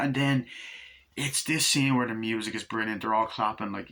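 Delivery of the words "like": ordinary talk, nothing marked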